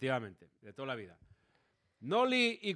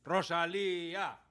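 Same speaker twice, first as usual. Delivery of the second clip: mock fan cheer; 5 dB quieter, slower than usual. Host playfully cheering on a friend, mock fan chant. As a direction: cheer like a crowd held at half volume. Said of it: "vamos a animar a Rosalía".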